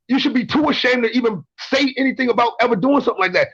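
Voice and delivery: usual speaking voice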